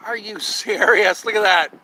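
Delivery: loud laughing outburst; laughing disbelief. Real, not acted. Cat poops on camera.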